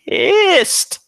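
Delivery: high and loud, a hammed-up yell; mock outburst. Podcaster warning Marvel and Netflix, playful loud outburst. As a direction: blow up on the last word, half joking. Threat if the show fails.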